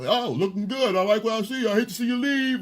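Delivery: high, singsong come-on; catcaller. Retold or imitated. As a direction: mimic a guy calling out cheesy compliments. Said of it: "because you yelling out".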